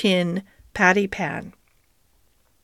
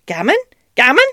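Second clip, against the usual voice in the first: rising, louder magpie squawks; squawking magpie; 5 semitones higher, 6 dB louder, faster than usual. Acted. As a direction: squawk it twice, building. Magpie doctor repeats his word.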